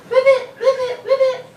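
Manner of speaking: high, punched puppet ribbits; Baby Ribbit. Pretend. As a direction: pipe up insistently as a tiny frog. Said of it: "Baby Ribbit".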